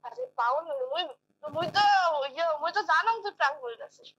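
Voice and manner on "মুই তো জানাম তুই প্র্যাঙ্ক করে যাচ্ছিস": usual speaking voice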